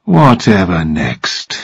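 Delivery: low, slow, weary exasperation; resigned and drawn out, pitched low; sighing demand. A narrator's reading. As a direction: sigh it out, dropping low. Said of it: "and sighed".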